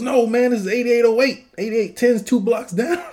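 high put-on voice, dismissive and casual; mocking valet guy. Retelling a movie scene to his co-host, high mock voice. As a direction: squeak it out like a goofy movie character. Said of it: "He goes".

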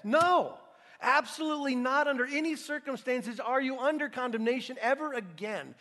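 No shouted high and loud, then driven on; emphatic shout of no. Acted. Preacher voicing Paul's answer to the struggle, shouting. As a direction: proclaim it at full force. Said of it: "here's what Paul says".

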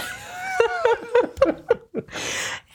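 real high-pitched laugh; high burst of laughter. Real, not acted.

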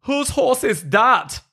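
high, slow put-on German trooper; hammed-up trooper demanding whose horse it is. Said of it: "So I'll play the German troopers".

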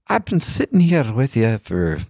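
high-pitched, rough attempt at an Irish accent; bad Irish accent. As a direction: imitate an old Irish guy, badly. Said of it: "not doing a very good Irish accent".

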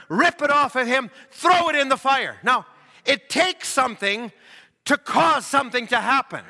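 high, forceful preacher delivery; full-force preaching. Acted. Preacher retelling a healing story to congregation, forceful high preaching. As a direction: drive every phrase home to the congregation.

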